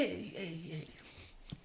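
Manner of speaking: ordinary delivery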